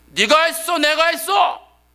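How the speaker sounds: high booming shout, the last words slowed. Retold or imitated. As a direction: thunder the question down at him. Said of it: "더 큰 톤으로 얘기하시는 거예요". (더 큰 톤으로 꾸짖는 하나님의 외침)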